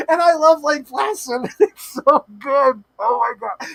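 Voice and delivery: high, laughing fangirl gush; gushing excitement. Real, not acted. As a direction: gush with delight, stretching the words. Gushing over favorite film with laughter.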